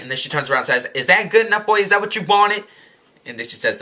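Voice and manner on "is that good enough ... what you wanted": higher-pitched mock sass; sassy challenge. Girl turns round to the bus driver, sassy retold challenge. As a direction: imitate her tossing back a defiant question.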